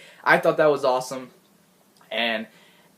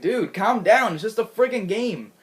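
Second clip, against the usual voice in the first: telling him to calm down; 7 semitones higher than usual. Retold or imitated. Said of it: "I was like".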